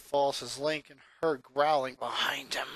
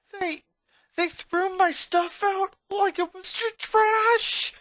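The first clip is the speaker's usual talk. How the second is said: put-on high boy voice, stammering and getting louder; high, wounded boy voice rising to a loud "trash"; hurt, angry boy; 5 dB louder, slower than usual. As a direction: choke out the betrayal. Lincoln finds his furniture burning.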